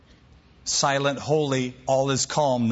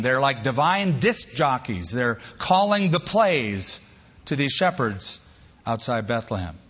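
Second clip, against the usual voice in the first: normal talking voice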